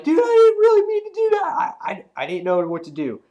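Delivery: squeaky high mock voice, loud then settling; cartoonish high-pitched stammer; flustered hero. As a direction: play the nervous kid apologizing. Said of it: "Bad's like".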